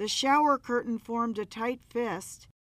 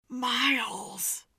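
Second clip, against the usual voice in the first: ordinary delivery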